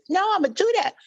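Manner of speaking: very high put-on character voice; Pee-wee voice. Insisting to his brother on keeping it, high-pitched character voice. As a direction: insist in a high, silly squeak. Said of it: "don't fucking talk like that".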